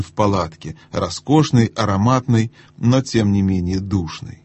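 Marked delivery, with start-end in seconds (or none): none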